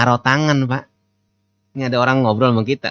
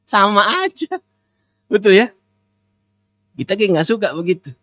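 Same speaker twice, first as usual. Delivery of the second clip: usual speaking voice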